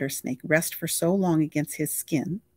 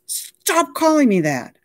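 slow, much higher and louder protest; irritated objection; 11 semitones higher, 7 dB louder, slower than usual. Acted. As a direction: spell out your annoyance. Mickey objects to the nickname.

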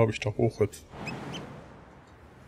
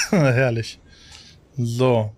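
usual speaking voice

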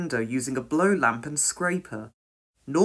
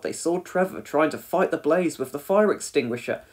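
ordinary delivery

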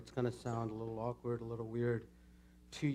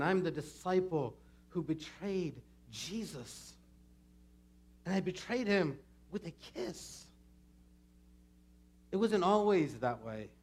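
higher, emphatic dramatic confession; Judas, despised and bitter; 5 semitones higher, 5 dB louder, slower than usual. Acted. Judas confessing his betrayal to the congregation, dramatic confession. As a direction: own the villain's name with force.

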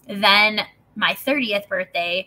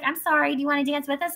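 higher sugary invitation; a hint of a tipsy stranger; mock sweet woman; 7 semitones higher than usual. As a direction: offer it brightly, mock-friendly. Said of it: "she's like".

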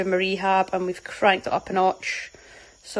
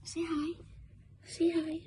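high, soft little coo; coaxing a pet; 8 semitones higher, 9 dB quieter than usual. Real, not acted. Owner asking the cat to say hi, cooing softly. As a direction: gently coax in a raised, hushed voice.

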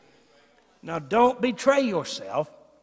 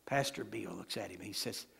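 normal talking voice

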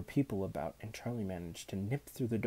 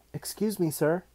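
higher, tentative schoolboy voice; polite schoolboy; 7 semitones higher, 6 dB louder than usual. A narrator's reading. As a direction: ask politely, pitched up. Charlie politely addresses teacher.

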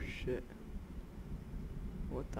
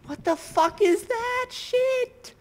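drawn-out, much higher, louder scream of horror; horrified shriek; 8 dB louder, much slower than usual. Real, not acted. A player seeing the monster up close, shrieking in horror. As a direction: let the words stretch into a terrified squeal.

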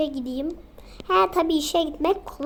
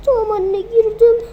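put-on crying child; 7 semitones higher, 9 dB louder than usual. Child crying after scolding.

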